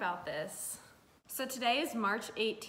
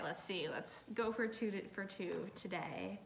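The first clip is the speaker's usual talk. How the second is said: normal talking voice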